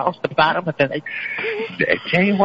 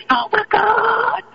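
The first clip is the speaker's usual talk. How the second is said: high, slow gasping "Oh, my God"; hammed-up shocked gasp; mock shock; much slower than usual. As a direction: drag it out in exaggerated disbelief. Mock shocked reaction to news.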